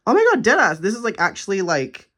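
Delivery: high, loud thrilled squeal; thrilled shriek. Real, not acted. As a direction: squeal at the gift like you can't believe it. Thrilled at personalized gift.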